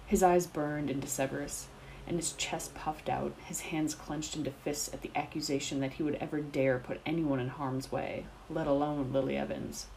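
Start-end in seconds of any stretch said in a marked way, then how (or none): none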